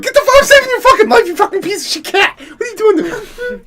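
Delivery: high, loud angry tirade; exasperated screaming. Retold or imitated. Owner retelling scolding the cat he's saving, furious high yell. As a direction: let fury and panic push the voice way up.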